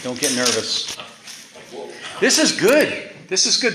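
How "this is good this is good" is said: high, loud excited exclamation; excited preaching. Real, not acted. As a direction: burst out with delight.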